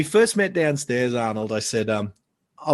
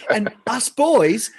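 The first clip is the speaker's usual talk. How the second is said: high-pitched, delighted punchline; gleeful; 10 semitones higher, 4 dB louder than usual. Real, not acted. Gleeful punchline about chatting with idol.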